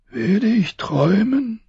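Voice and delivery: high, strained voice of a dying king. Acted. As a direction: push each word out through pain. Robert's last question.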